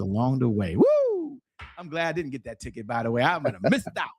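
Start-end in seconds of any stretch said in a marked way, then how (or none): 0.8-1.4 a high, loud whoop of delight; excited whoop. Real, not acted. Host cheering aloud at his own luck, high loud whoop. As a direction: let out a joyful woo. Glad he missed the ticket.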